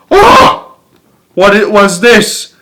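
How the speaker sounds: high, loud burst of surprise; startled gasp. A narrator's reading. Young beetle sees a huge creature, startled and high. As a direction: gasp at something enormous.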